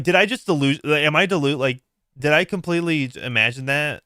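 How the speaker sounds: normal talking voice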